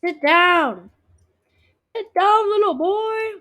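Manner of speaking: loud high yell. Real, not acted. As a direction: shout the order at him. Yelling at player in game.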